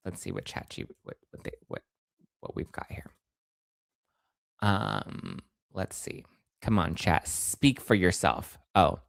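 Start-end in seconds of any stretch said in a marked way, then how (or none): 0.1-6.2 under-breath, voiceless mumbling while waiting; muttering to self. Real, not acted. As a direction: mumble to yourself as you wait.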